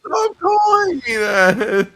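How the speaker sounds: high, slow, drawn-out wail; mock whine. Friend protesting the insult, mock whine. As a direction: whine it out like you're wounded.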